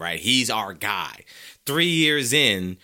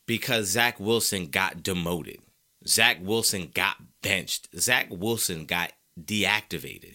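slow, stressing Zach each time; slow, deliberate list; emphatic list; 3 semitones lower, slower than usual. Real, not acted. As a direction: hammer each line slowly. Listing emphatic rant.

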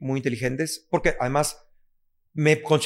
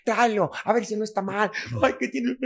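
a hint of a fussing first-time parent, higher; anxious new parent; 5 semitones higher than usual. Parent fussing over first child's fall, mildly high. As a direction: lightly play a worried parent over a fall. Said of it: "esta cosa que dicen".

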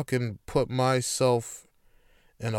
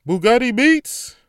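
higher, louder hype drop; producer tag; 8 semitones higher, 8 dB louder than usual. Shown as a demonstration. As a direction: announce it like a beat tag. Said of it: "say Bugatti Beats like that".

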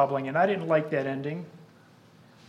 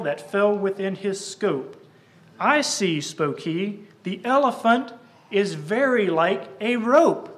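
poem recitation; 3 semitones higher, 4 dB louder than usual. Acted. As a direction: declaim the verses to the congregation. Recites the blind men poem.

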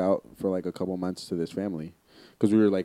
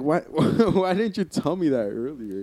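retold protest, pitched up with a laugh; higher, half-laughing mock indignation; 7 semitones higher, 3 dB louder than usual. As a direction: laugh through an incredulous complaint to mom.

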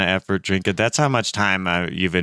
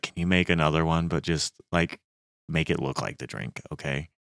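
hushed request; 4 semitones lower, 7 dB quieter than usual. Retold or imitated. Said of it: "I was like".

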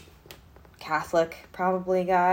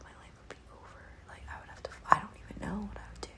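hushed unvoiced whisper so God won't hear; 9 dB quieter than usual. Real, not acted. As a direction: whisper it like a secret you fear to jinx. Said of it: "I don't even wanna say this loudly".

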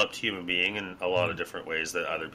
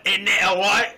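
high, jerky imitation of a young Brit; mock young Brit accent; 11 semitones higher, 5 dB louder than usual. Mocking how young Brits talk, in a high jerky British accent. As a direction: squeak it out like a London teen. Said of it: "the young Brits now and they're like".

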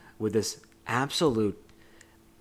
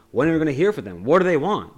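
higher, louder upset plea; distressed, raised-voice retelling; upset wife; 5 semitones higher, 7 dB louder, faster than usual. Retold or imitated. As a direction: act out an upset wife demanding news. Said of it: "she's so upset".

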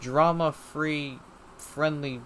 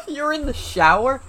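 surprised; 11 semitones higher, 10 dB louder than usual. Real, not acted. Surprised at friend in shower.

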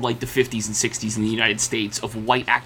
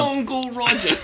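Uncle Roger; 9 semitones higher than usual. Retold or imitated. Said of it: "who was doing the Uncle".

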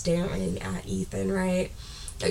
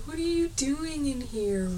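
mock Ethan; 8 semitones higher, 5 dB quieter, slower than usual. Ethan voiced in retelling.

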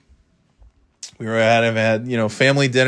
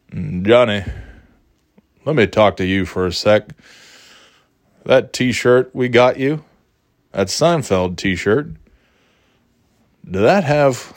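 Midwestern Southern drawl; 2 semitones lower than usual. Retold or imitated. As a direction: stretch it out in a mild Midwestern Southern grandpa drawl. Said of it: "kind of Midwestern Southern drawl".

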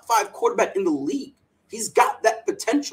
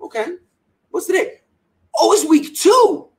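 mock bewilderment; 8 semitones higher, 5 dB louder than usual. Retold confusion over the date.

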